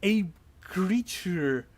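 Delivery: suspenseful scene-setting, stretched words; dramatic reveal. Acted. Game master reveals a sudden creature attack.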